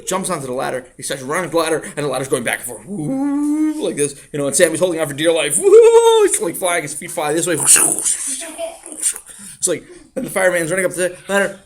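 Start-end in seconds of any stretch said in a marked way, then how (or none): 5.6-6.2 high loud woo of swaying; whoop. Acted. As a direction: swoop the voice like the ladder sways. Ladder swaying.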